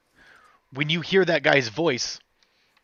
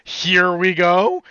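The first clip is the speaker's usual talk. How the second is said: slow, louder Mario quote; Mario catchphrase; 7 semitones higher, 10 dB louder, much slower than usual. Retold or imitated. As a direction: stretch it out like Mario. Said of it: "isn't talking".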